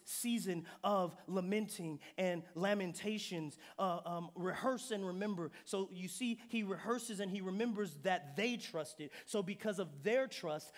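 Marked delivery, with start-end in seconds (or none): none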